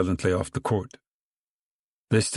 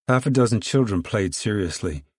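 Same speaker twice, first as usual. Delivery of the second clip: ordinary delivery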